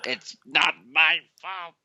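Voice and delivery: slow, drawn-out mock indignation; mock exasperated protest. Defending his bad play to friends, mock exasperated. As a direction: stretch out every word in playful protest.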